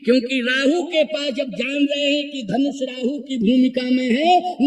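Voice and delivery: usual speaking voice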